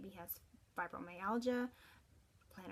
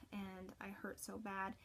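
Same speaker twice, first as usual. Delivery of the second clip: whispered hurt; 9 dB quieter than usual. Retold or imitated. Son's pain quoted in whisper.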